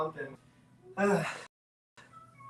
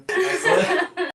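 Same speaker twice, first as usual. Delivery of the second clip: laughing; 12 dB louder than usual. Real, not acted. Laughs at own slipping.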